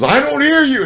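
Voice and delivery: high, loud barking yell; drill instructor scream. Retold or imitated. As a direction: scream it at the recruit. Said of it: "he would scream it out".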